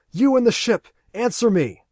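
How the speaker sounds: loud, high demand; commanding shout. Acted. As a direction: shout into the radio. Aaron yells over the radio.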